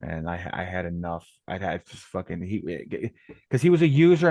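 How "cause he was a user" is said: louder, higher and heated; angry outburst. Real, not acted. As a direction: spit out the word user.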